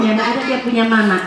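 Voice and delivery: usual speaking voice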